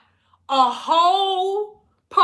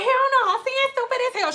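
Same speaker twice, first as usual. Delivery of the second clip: client voiced high; 11 semitones higher, faster than usual.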